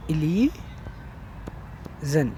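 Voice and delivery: softly stretched-out "illusion" for note-taking; syllable-by-syllable dictation. Shown as a demonstration. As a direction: dictate "illusion" slowly in pieces. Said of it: "please write down".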